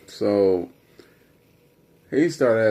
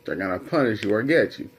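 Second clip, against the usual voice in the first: ordinary talk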